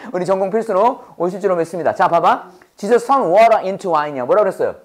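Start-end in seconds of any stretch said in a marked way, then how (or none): none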